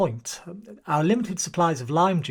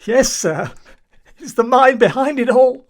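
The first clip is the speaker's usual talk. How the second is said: higher, louder frantic insistence; insistent outburst; 5 semitones higher, 8 dB louder than usual. Acted. Paranoid seaman convincing the chief, frantic insistence. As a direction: insist it's true, almost shouting.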